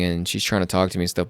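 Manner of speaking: ordinary talk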